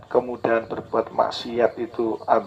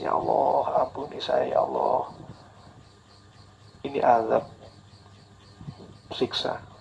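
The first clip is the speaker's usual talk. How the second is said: hushed, softer plea fading to a whisper; tearful prayer; 5 dB quieter than usual. Pretend. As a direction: beg forgiveness quietly, close to tears. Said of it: "Itu segera menangis".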